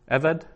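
Eved stressed on E, as EH-ved; stress on first syllable. Shown as a demonstration. Teacher shows students where Hebrew stress falls, first-syllable stress. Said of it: "the accent is on the first syllable".

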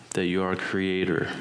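usual speaking voice

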